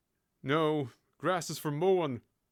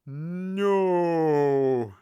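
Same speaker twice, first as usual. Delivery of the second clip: "No" stretched out long and loud; slow, condescending; 7 dB louder than usual. Acted. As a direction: draw "No" right out as if for a slow listener. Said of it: "I'll say it slow for you".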